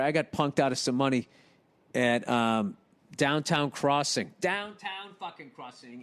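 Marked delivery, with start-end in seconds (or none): 4.4-6.0 soft, drawn-out repeat; hushed emphasis. Real, not acted. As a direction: savor it slowly, dropping the volume. Repeats place name for effect.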